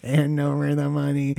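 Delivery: lightly imitated robot voice; flat mechanical drone demand. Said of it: "it's a robot voice".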